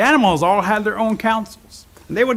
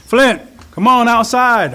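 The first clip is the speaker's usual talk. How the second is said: higher, louder call to someone outside; 5 semitones higher, 6 dB louder, slower than usual. A narrator's reading. Rabbit calls sleeping Flint out of the mountain, calling out. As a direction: call him out from his sleep.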